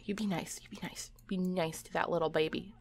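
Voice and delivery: hushed, voiceless whisper; very soft whispered admonishment; whispered warning. Real, not acted. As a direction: whisper a gentle order under your breath. Quiet warning to be nice.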